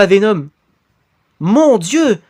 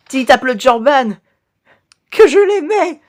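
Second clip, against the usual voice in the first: overacted death scene; 5 semitones higher than usual. Dying character's last words.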